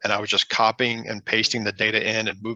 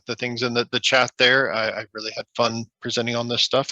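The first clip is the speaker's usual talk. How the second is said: usual speaking voice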